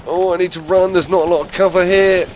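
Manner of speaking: urgent, loud and high; panicked shout. Real, not acted. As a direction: yell it while running for cover. Fleeing under fire.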